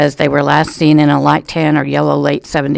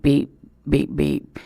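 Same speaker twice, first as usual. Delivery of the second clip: soft mimicked off-hook beeping, fading away; imitated phone beep; 7 dB quieter, slower than usual. Reporter mimics the off-hook phone, soft fading beeps. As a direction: echo the dangling receiver's beep, dying down. Phone receiver left dangling.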